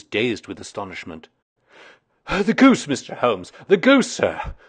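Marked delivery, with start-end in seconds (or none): 2.3-4.6 breathless, high, loud cry; gasped excitement. A narrator's reading. Commissionaire rushing in to Holmes, gasping out the news. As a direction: Peterson bursting in, gasping with excitement. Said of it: "he gasped".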